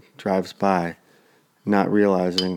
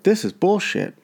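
imitated outrage, pitched high; retold angry protest; 11 semitones higher than usual. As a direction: mimic an indignant man, voice jumping up. Retold irate man protesting.

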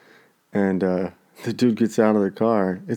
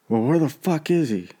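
mock-angry neighbor, higher pitch; retold angry demand; 7 semitones higher than usual.

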